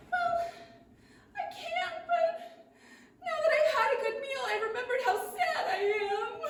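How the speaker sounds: high, tearful little voice breaking into sobs; crying dragonfly. Acted. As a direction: whimper it like a heartbroken bug. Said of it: "the dragonfly started to cry".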